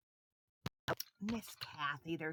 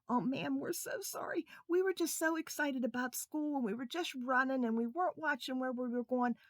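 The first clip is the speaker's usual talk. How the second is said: put-on higher, sorry little boys; apologetic boys; 7 semitones higher, 7 dB louder than usual. Boys to the lady, apologetic. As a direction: plead sorry like a child. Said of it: "And Charlie said".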